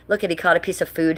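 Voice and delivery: normal talking voice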